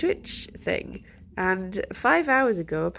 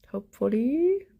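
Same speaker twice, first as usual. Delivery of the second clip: higher, lilting hopeful rise; 5 semitones higher than usual. Real, not acted. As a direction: lift it up hopefully. Wishful repeat of hopefully.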